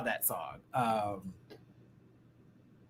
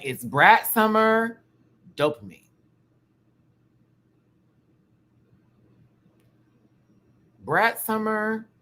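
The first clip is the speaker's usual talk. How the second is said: drawn out; 10 semitones higher, 5 dB louder, slower than usual. Pretend. Drawn-out hype.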